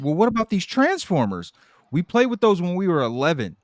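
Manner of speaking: high, eager mock mom; pushy mom voice. Co-host voicing the grieving mom, high and eager. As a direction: push the toys on him sweetly.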